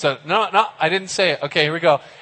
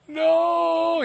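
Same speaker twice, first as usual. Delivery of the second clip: high shrieked mock no; a sudden high panicked no; 13 semitones higher than usual. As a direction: squeal it in mock terror. Jacob panicking at charging Esau.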